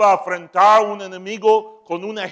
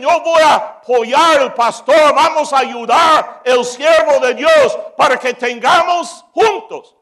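loud, higher-pitched rallying cry; rallying shout; 5 semitones higher, 7 dB louder than usual. Acted. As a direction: shout it like volunteers rushing to back their pastor. Men rallying to help the pastor.

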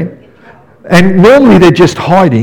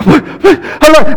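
high, light hello, still calm; light calling; 9 semitones higher than usual. Retold or imitated. Dad first calling for his missing son, light and high. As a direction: call out lightly before the panic sets in. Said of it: "your heart starts going".

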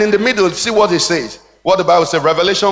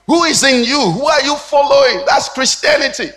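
high, driving pulpit delivery; impassioned, raised sermon voice; forceful preaching; 5 semitones higher, 4 dB louder than usual. Real, not acted. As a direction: preach it hard, building to an emphatic cry.

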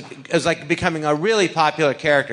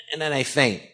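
ordinary talk

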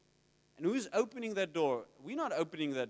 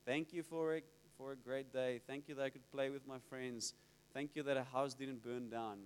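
low, soft recited thanks; murmured list of thanks; routine child's prayer; 6 semitones lower, 9 dB quieter than usual. Retold or imitated. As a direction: imitate a kid's rote bedtime prayer. Imitating kids' routine bedtime prayers.

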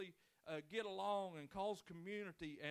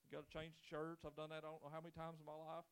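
ordinary talk, nothing marked

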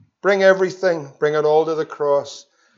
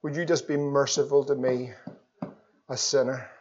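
humble plea; 2 semitones lower, 7 dB quieter than usual. Retold or imitated. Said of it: "he's crying out".

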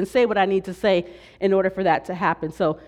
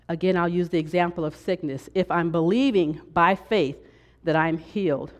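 ordinary talk

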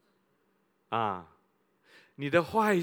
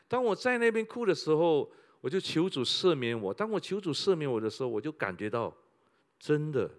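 ordinary talk

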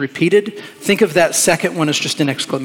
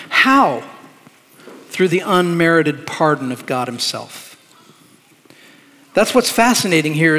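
ordinary talk

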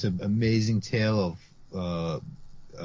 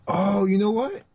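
surprised; 11 semitones higher, 5 dB louder than usual. Real, not acted. Sudden remembering.

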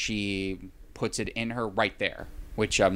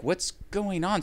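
higher and drawn-out, mock bewildered; mock alarm; 10 semitones higher, slower than usual. Retelling the twist, a mock bewildered cry. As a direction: act out a bewildered gasp at the twist. Mock panic at sudden killing.

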